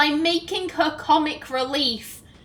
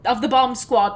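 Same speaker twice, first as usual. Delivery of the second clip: normal talking voice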